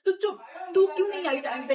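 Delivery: high, loud shout cut with laughter; mock yell. Senior boy shouting down the girl, mock loud yell. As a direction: snap at her to shut up. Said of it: "उसने बोला".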